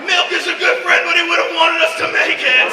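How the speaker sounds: rally the party like a melodramatic pioneer; hammed-up desperate survivor's cry; mock-heroic rallying cry. Mock trail survivor rallying over groceries.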